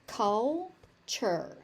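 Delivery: "Culture" split as cul... chur; syllable by syllable. Shown as a demonstration. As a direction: pause before the second syllable of "Culture". Teacher breaks word into syllables.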